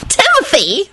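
high, giggly chiding. A narrator's reading. Sludge scolds Timothy, giggling. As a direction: chide him in a high, amused voice. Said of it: "Sludge giggled".

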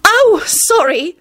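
high, slow, flustered apology; stammered apology. A narrator's reading. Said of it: "she stammered out".

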